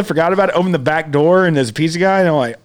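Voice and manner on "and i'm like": ordinary delivery, nothing marked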